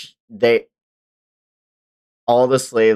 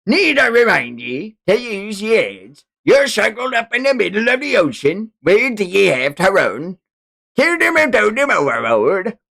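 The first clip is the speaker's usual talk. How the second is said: sneering pirate captain, raised pitch; slaver captain; 10 semitones higher, 4 dB louder than usual. Acted. Streamer reading manga as the slaver captain, gloating.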